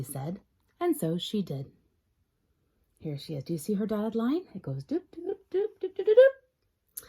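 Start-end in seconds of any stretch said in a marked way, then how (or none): 4.8-7.0 doops climbing higher, last one loud; playful climbing doops; rising doop sounds. Acted. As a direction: sing the doops up the line. Tracing dotted line.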